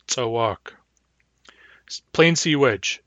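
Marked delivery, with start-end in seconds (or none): 0.1-0.7 short first, long stressed second syllable in "Tsawak". Shown as a demonstration. Teacher showing vowel length to learners, "Tsawak" stressed on its long second syllable. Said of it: "Short long".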